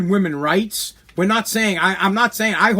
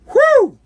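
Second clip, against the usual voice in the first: sudden high loud whoop; mock whoop; 7 dB louder than usual. Comic mocking bachelor parties, high loud whoop. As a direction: let out a hammed-up party whoop.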